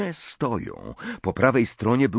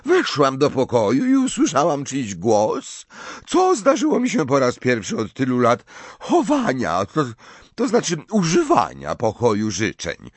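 Professor Trelawney; 4 semitones higher, 3 dB louder than usual. Acted. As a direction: play the tipsy, offended seer, pitched way up. Trelawney tells what happened.

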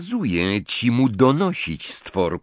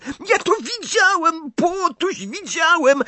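very high, squawking indignant poltergeist; indignant screech. A narrator's reading. Poltergeist screeching at the house-elves in outrage. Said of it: "zaskrzeczał z oburzeniem".

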